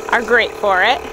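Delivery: ordinary talk, nothing marked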